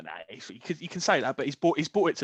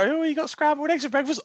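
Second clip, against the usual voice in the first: higher-pitched mum voice; 8 semitones higher than usual. Acted. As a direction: put on a mum calling about breakfast. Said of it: "your mum go".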